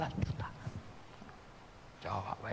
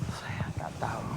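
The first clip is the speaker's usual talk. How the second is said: whispered aside; 2 semitones lower, 7 dB quieter than usual. Real, not acted. An aside about a mystery.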